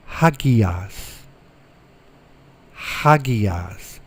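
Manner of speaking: Hagias with a breathy h at the start, slow and deliberate; careful model pronunciation. Shown as a demonstration. Teacher modelling vocabulary for students, slow careful Hagias. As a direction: say Hagias clearly for the class to repeat. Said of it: "Rough breathing".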